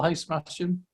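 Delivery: normal talking voice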